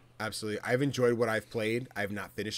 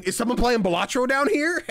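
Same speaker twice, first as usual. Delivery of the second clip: high, louder curious call; surprised visitor; 12 semitones higher, 7 dB louder than usual. Retold or imitated. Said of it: "you hear someone".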